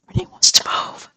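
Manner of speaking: unvoiced, awed whisper; stunned whisper. Real, not acted. Gamer repeating the villager's news, stunned whisper. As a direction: whisper it like you can't believe it.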